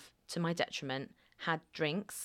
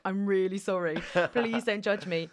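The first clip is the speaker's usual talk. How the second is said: retold sheepish pleading, raised pitch; acted-out embarrassed apology; 2 semitones higher, 4 dB louder than usual. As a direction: plead not to be judged, mock-embarrassed. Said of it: "being embarrassed".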